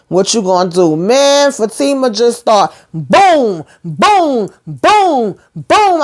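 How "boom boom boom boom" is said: drawn-out booms climbing in pitch; acted punch noises. Retelling Fatima throwing punches, gleeful booms. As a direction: punch out each boom, higher each time.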